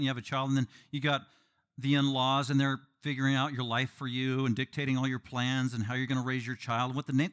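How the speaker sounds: ordinary talk, nothing marked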